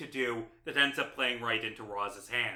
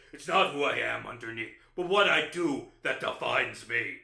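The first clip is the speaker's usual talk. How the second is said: Batman voice; 5 semitones lower, 4 dB louder than usual. Retold or imitated. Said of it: "and he says".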